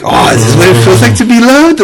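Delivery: high-pitched, gushing mock emotion; playfully touched. Parent moved that child wants to wait, playfully touched. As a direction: melt at being loved, hammed up.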